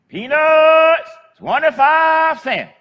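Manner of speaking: high, loud, drawn-out peanut seller's call; street vendor's cry. Retold or imitated. Old lady selling peanuts calls to passing traffic, loud high street cry. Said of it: "and she'd say".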